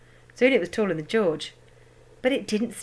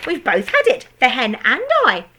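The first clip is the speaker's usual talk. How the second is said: gloating grandma; 5 semitones higher, 7 dB louder than usual. A narrator's reading. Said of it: "Grandma shouted".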